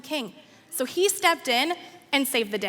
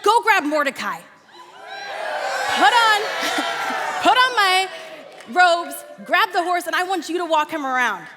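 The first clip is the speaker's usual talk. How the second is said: shouted order; 6 semitones higher than usual. Acted. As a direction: bark the orders across the courtyard. King shouting orders to Haman.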